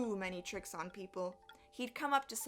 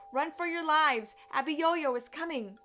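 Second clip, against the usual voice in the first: raised, higher storyteller's shout; alarmed warning cry; 5 semitones higher, 6 dB louder than usual. Acted. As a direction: call out the danger to the village. Villagers flee the coming giant.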